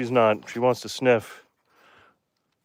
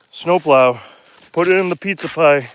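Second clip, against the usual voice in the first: ordinary delivery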